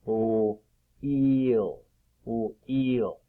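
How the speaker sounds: wheel split into "Ooh" and "eel"; sounded out. Shown as a demonstration. Teacher testing pupils on wheel, sounds drawn apart. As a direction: hold "Ooh", pause, then a long "eel". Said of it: "Is it the eh sound?".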